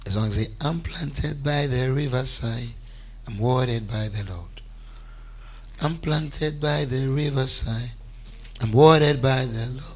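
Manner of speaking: low, slow, measured affirmation; led declaration. Real, not acted. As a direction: intone each phrase for the congregation to repeat. Leads congregation in declarations.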